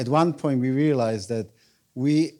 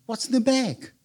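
curious lady; 9 semitones higher than usual. Retold or imitated. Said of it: "she said to me".

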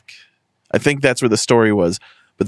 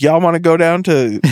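a quick put-on Southern accent, pitched up and a bit louder; jokey Southern accent, voice raised; 7 semitones higher, 3 dB louder than usual. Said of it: "I was talking with a Southern accent".

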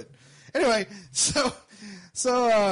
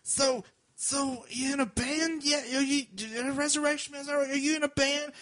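put-on drunk woman; 8 semitones higher than usual. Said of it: "she was also like".

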